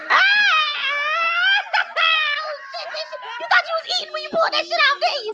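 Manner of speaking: high voice. Acted. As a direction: rattle off the insult up high. Catwoman roast in a shifted voice.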